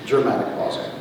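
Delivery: ordinary talk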